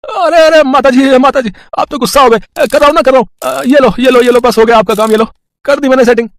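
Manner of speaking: high, loud, hurried soothing repairman; calm the angry old woman fast; placating electrician. Acted. (गुस्सा हुई माताजी को मनाता बिजली वाला, ऊँची तेज़ आवाज़)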